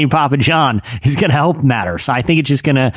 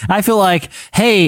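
ordinary delivery

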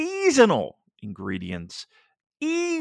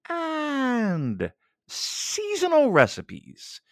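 drawn-out high voice; 13 semitones higher, much slower than usual. Acted. Goofy ad read.

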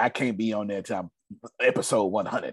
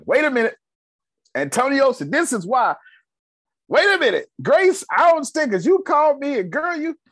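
loud, high-pitched exclaiming; calling out; 7 dB louder than usual. Real, not acted. Host to listeners not paying attention, loudly calling out. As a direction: raise the voice high to grab everyone's attention.

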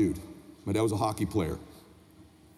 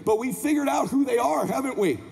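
high, driving motivational sermon; motivational preaching; 9 semitones higher than usual. Acted. Speaker challenging parents to be their children's hero, impassioned preaching. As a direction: drive each phrase home like a preacher. Motivational speech at full force.

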